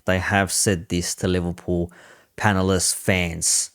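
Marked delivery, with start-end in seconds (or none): none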